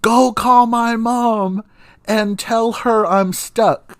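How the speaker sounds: high, slow call across the water; calling out to cousin. Retold or imitated. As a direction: shout slowly and high to someone on shore. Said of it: "and I tell them".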